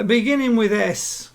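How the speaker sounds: ordinary talk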